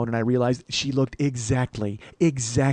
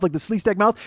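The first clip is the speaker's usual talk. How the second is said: ordinary talk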